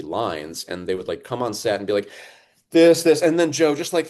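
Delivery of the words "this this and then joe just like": high, loud, eager push from the director; excited director. Retold or imitated. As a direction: hype him up like a director on set. Directors coming on set urging him.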